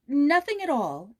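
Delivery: loud, slow and firm, putting on courage; steady the frightened boy with a brave front; a girl hiding her fear behind a bold answer; brave reply. A narrator's reading. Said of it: "answered Joyce bravely".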